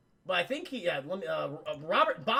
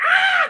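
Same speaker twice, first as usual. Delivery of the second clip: a very high, very loud exaggerated scream; hammed-up shriek; 14 dB louder than usual. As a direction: overdo the shock into a shriek. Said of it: "he goes like".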